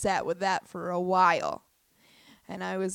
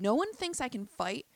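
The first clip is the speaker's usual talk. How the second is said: ordinary talk, nothing marked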